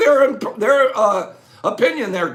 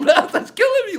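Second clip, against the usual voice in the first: laughing; 10 semitones higher than usual. Real, not acted. Laughing at a contestant's answer.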